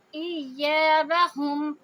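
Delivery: ایابہم very slow, یا held two counts; Quran recitation. Shown as a demonstration. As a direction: stretch the یا in ایابہم. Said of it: "حمزہ کو ایک حرکہ کریں گے، یا کو دو حرکہ". (ایابہم میں یا کو دو حرکہ لمبا کریں)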